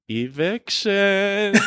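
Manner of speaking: high, slow sing-song "Eviction"; sing-songy voice. Retold or imitated. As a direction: croon it like a gleeful landlady. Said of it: "she says in this sing-songy voice".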